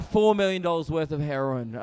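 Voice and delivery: normal talking voice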